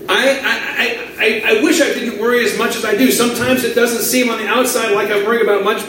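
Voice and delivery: normal talking voice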